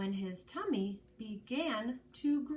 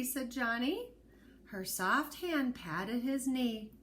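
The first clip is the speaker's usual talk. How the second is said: ordinary delivery, nothing marked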